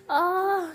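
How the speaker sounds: sudden high loud cry of pain; scream of pain. Acted. Aana rising from the cupboard and screaming in pain. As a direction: yelp as the pain hits. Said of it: "वो इतने ज़ोर से चिल्लाती है".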